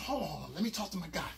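low and soft, keep it between us; quiet hold-on to the others; hushed aside. Retold or imitated. Telling the crew quietly to hold on.

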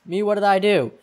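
high, loud protest of innocence; protesting accused. Acted.

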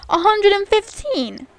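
high, loud outburst of disbelief; shocked at the price. Real, not acted. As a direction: balk loudly at the cost.

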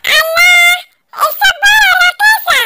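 high, squeaky toy echo; talking cactus toy. Acted. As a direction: parrot it back in a high, loud toy voice. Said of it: "الصبارة ردت عليا".

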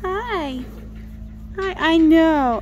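ordinary delivery

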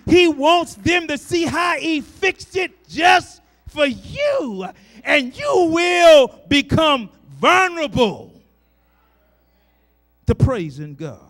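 loud, rising preacher's cry; full-voiced gospel preaching; preaching at full force. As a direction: preach it out to the congregation. Shouted preaching climax.

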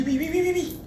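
quick rising eager oui oui oui; eager yes to the job offer; eager yeses. Retold or imitated.

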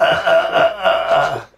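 normal talking voice

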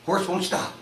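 normal talking voice

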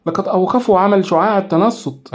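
exclaiming. A narrator's reading. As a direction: blurt it out in alarm. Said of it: "هتفت نشوى".

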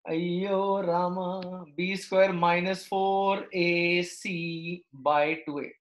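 slow, drawn-out Ayyo Rama sigh; south indian accent. Acted. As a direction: groan it out in a south Indian lilt. Sighs at an ugly formula.

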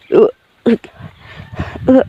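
dry heaving retch, straining from the throat; a couple of harsh choking retches; retching gag. Acted. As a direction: gag as if the stomach is turning over. Retching in the bathroom.

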